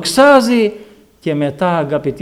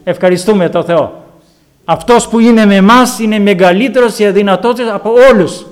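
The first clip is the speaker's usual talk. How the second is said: preaching; 3 semitones higher, 6 dB louder than usual. Acted. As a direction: thunder out the grace of God. Preaching at full force.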